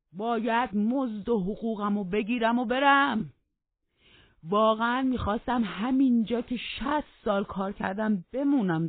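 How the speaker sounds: put-on high, loud quavering old man's complaint; aggrieved old servant. Joseph complains to the master about his garden, loudly aggrieved. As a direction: rant like an offended old servant.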